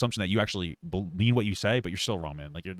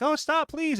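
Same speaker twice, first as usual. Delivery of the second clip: squeaky falsetto plea; mock woman's plea; 6 dB louder, much slower than usual. Imagining him pretending to be her, squeaky high mock plea. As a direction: pitch way up like a pretend frightened woman. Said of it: "pretending to be her and was like".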